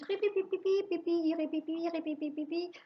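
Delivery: high squeaky mouse chatter; mouse squeaking. A narrator's reading. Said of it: "fait tout un discours". (couinements de souris aigus)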